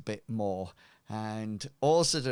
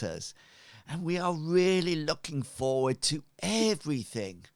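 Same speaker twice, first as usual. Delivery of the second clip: grand cult leader; 3 semitones higher than usual. Acted. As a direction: greet your flock with pompous warmth. Leader's speech introducing sorcerer.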